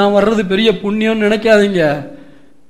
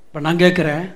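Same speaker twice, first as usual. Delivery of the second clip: hushed question; 5 semitones lower, 8 dB quieter than usual. Real, not acted.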